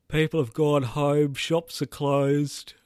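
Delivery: talking through a yawn; stretched, slow words mid-yawn. Real, not acted. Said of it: "I'm yawning and talking at the same time".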